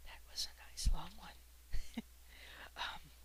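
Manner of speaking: ordinary delivery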